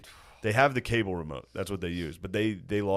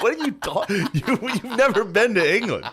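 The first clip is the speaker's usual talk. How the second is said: high-pitched exasperated comeback; incredulous retort; 11 semitones higher, 4 dB louder than usual. Retold or imitated. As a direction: protest in disbelief, on the edge of laughing. Acts out own retort to Phil.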